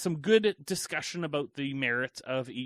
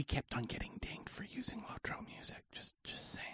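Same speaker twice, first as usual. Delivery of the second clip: hushed unvoiced aside; whispering; 5 semitones higher, 14 dB quieter than usual. Real, not acted. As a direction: whisper it like a secret. Secretive aside about music strikes.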